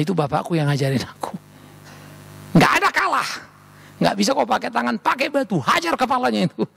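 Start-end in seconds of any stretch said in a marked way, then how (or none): 2.5-6.7 high, forceful acted orders; raised-voice fatherly command; fierce father. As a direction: egg the boy on to fight. Acting father's fighting orders to son.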